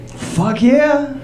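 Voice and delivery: ordinary talk, nothing marked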